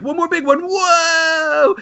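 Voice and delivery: high, building to a long whoa; big excited whoa. Retold or imitated. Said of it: "I was like".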